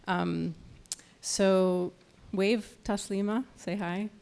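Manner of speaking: usual speaking voice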